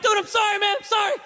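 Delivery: high, loud, panicked apology; alarmed high-pitched pleading; frantic apology. Acted. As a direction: blurt it out while hauling back the dog. Apologising after dog lunges.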